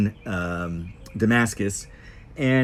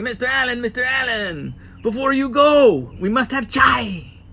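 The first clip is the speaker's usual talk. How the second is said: put-on Syrian accent; 12 semitones higher, 4 dB louder than usual. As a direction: sing it out high in a mock Syrian accent. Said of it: "pardon the accent".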